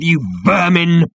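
slow, high growled insult; drawn-out interrogator's snarl; menacing growl. A narrator's reading. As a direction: spit the insult out, stretched. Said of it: "he growled".

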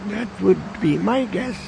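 put-on calm old man voice; unbothered old man. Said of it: "the old man replied calmly".

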